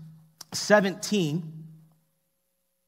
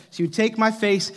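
usual speaking voice

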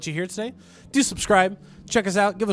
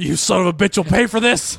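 loud mock-angry threat; hammed-up tantrum in a put-on voice; Bob Ross; 5 dB louder than usual. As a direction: snarl it like a TV painter losing it. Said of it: "Bob Ross had anger issues".

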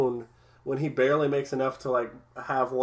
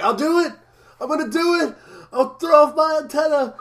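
high, loud mock desperate yell; shouted imitation of a cartoon outburst; worked-up imitation; 12 semitones higher, 5 dB louder than usual. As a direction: act out a frantic, high-pitched vow. Acting out Bender's desperate vow.

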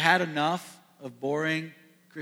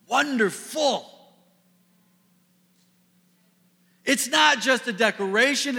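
louder, higher, driving the sermon home; preaching at full force; 5 semitones higher, 4 dB louder than usual. Acted. Pastor proclaiming the wonder of the cross, preaching at full force. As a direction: proclaim it to the whole room.

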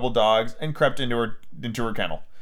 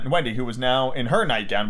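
usual speaking voice

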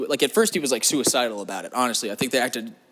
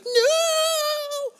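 way higher, wailing mock scream of despair; anguished cry. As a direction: cry out as the rocket leaves without you. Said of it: "and you're like".

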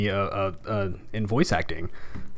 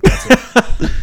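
ordinary talk

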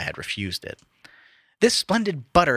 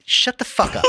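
mock outrage; 13 semitones higher, 4 dB louder than usual. Real, not acted. Reacting to a pun.

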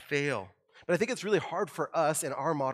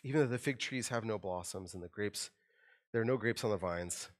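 "Even though the fig trees" read softer, heavy with sadness; sad, soft reading; 5 semitones lower, 6 dB quieter than usual. Shown as a demonstration. Preacher rereads the verse, softer and sorrowful. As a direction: let the loss sink in quietly. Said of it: "you feel the sadness in that".

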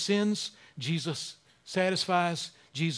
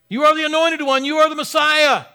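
loud confession; 9 semitones higher, 10 dB louder than usual. Pretend. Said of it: "every word in the dictionary".

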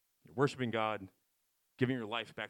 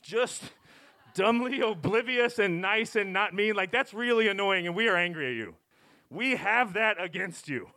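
mock annoyed; 6 semitones higher, 5 dB louder than usual. Mock annoyed at nice people.

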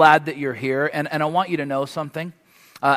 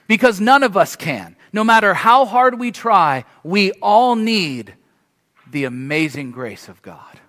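louder, rising preacher's build; fervent preaching; 3 semitones higher, 5 dB louder than usual. Acted. Preacher insisting to his church that nobody lives perfectly, fervent and louder. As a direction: drive the point home to the congregation.

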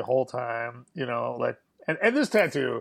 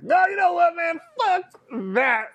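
high, loud mock outburst; Carl; 5 dB louder than usual. Bully rejecting his own tattoo idea, mock shouting. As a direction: imitate a bully abruptly yelling his change of mind. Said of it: "I have to quote Carl".

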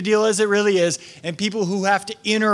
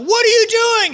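much higher, louder, a startled servant's cry; alarmed servants; 4 dB louder than usual. Retold or imitated. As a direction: blurt it out like a panicked servant. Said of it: "his servants are gonna be like".

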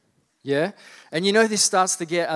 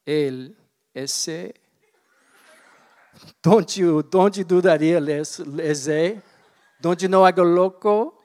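hammed-up Mexican accent, louder on the threats; mock Mexican accent; 4 dB louder, slower than usual. Boy warning his sister at the sink in a mock Mexican accent. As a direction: play a tough guy with a Mexican accent. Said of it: "I think I'm, like, Mexican".

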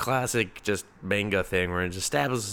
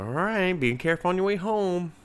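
light ice cream man; 9 semitones higher than usual. Retold or imitated. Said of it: "He's like".

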